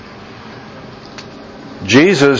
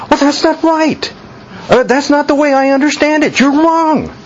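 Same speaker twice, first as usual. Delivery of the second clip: high-pitched indignant contradiction; mock protest; 12 semitones higher than usual. Believer talking back to God's Word, mock protest. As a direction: push the pitch way up, acting out an objection.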